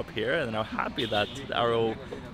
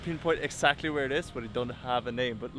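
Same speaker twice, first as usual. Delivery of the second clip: ordinary talk, nothing marked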